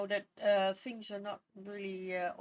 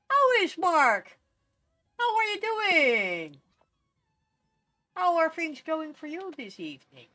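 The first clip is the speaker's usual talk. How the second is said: cheery greeting; 4 semitones higher, 5 dB louder than usual. Acted. Greeting a Sim warmly.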